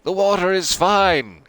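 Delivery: high, loud call across the beach; yelling. Acted. Louise yelling happily from the water, loud. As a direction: shout it out over the water. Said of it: "Louise yelling".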